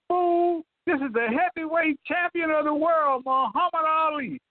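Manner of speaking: high, proud champion's boast; imitating Ali boasting. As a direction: announce yourself like the champ. Ali announcing himself on phone.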